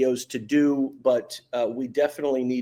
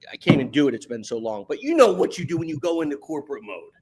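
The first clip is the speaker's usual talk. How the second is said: ordinary delivery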